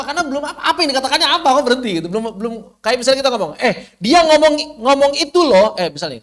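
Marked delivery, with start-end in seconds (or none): 3.6-5.8 high, loud cut-off chatter; mock chatty gossip. Teacher acting a speaker who stops mid-sentence, mock chatty.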